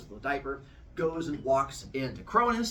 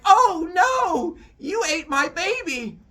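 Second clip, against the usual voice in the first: hammed-up loud, high, slow lament; badly faked outrage, drawn out and shrieked; fake horrified wail; 9 dB louder, slower than usual. Wife pretending her baby was eaten, hammed-up horror.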